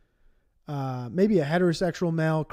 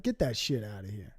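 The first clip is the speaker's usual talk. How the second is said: gruff dismissal; 9 semitones lower, 8 dB quieter than usual. Retold or imitated. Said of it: "They're gonna be like".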